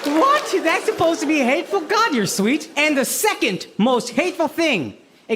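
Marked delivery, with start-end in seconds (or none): none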